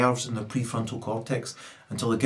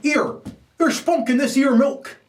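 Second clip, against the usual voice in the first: mock father complaint; 12 semitones higher, 3 dB louder than usual.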